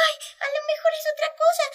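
very high, fast frightened squeak; scared chick. Acted. Chick too scared to turn round, high and fast. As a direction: gabble it out in fear. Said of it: "tenía mucho miedo y dijo".

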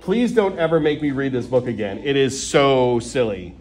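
ordinary delivery, nothing marked

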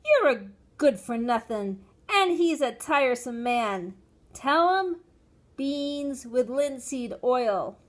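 loud irritation ending in deliberate instruction; exasperated scolding. A narrator's reading. Mother scolds and instructs child.

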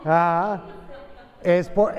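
usual speaking voice

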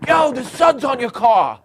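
high, drawn-out street holler; Italian guy hollering. Acted. Italian guy at a stoplight hollering at the driver. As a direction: call out slowly to the next car. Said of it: "There's this Italian guy".

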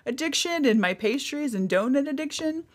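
high-pitched retold dismay; hammed-up scandal in a high voice; mock betrayal. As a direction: act out dismay at being exposed. Realising her friend betrayed her secrets.

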